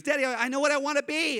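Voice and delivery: put-on high, quick little-kid voice; five-year-old daughter. Daughter bursting to tell dad her plans, high childish eagerness. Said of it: "and she goes".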